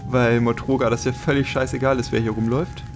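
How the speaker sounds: normal talking voice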